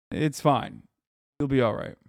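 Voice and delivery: ordinary delivery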